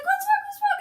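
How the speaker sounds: high, loud, frantic mum imitation; alarmed mum. Imitating mum rushing into the room, high alarmed voice.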